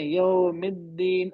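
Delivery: یوم الدین slow, with the د of الدین pressed hard; hard doubled dal. Shown as a demonstration. Teacher demonstrates tajweed stress on dal. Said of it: "ادھر نرمی سے نہیں".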